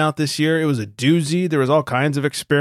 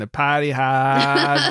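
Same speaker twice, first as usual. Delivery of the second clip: slow Boston drawl on potty hard; Mayor Quimby; slower than usual. Acted. Hosts joking on the beer podcast, in a slow mock Boston drawl. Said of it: "like, Mayor Quimby at the end".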